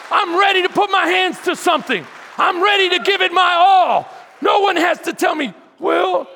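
loud fervent sermon; booming motivational speech. Acted. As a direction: preach it hard, full force. Preaching at full force.

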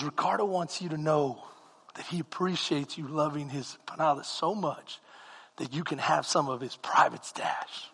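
low, soft confiding voice; quietly confiding a secret stash; hushed confiding. Retold or imitated. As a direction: let him in on a secret.

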